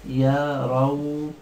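drawn-out word. Shown as a demonstration. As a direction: stretch يرون very slowly, syllable by syllable. Teacher sounds out word slowly. (تمطيط يرون ببطء شديد)

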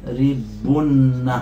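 يضربن drawn out very slowly, each syllable spaced; slow model word for students; slow demo. Shown as a demonstration. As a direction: spell out يضربن slowly for the class. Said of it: "ماذا تصير في النهاية؟".

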